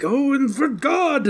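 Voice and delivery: high, slow, overblown line reading; mock a ridiculous dramatic movie line, stretched out; hammed-up movie villain. Mocking Gary Oldman's absurd line, slow hammy villain reading. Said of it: "they does a thing like".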